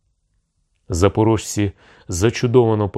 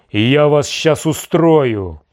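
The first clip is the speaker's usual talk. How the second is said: loud, high, drawn-out threatening shout; 7 semitones higher, 5 dB louder, slower than usual. A narrator's reading. Tsarevich threatens the envoys with raised sabre, shouting. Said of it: "закричав Симеон до послів".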